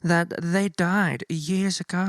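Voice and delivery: put-on high, slow, grand old-movie actress voice; fifties film diva. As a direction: ham up a dramatic fifties screen diva. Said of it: "she's very much like that".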